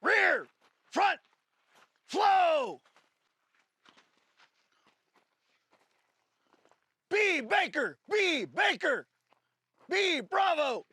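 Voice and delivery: loud high commands to the class; shouted drill calls. Real, not acted. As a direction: call each position out hard. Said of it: "calling drill positions".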